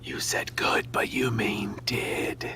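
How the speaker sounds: slow hushed whisper. Acted. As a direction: whisper it slowly. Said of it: "Why are we whispering this?".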